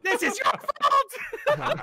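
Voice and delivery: very high, drawn-out playful yell; mock accusing shout. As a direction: wail the blame in mock outrage. Acted accusation shouted at mom.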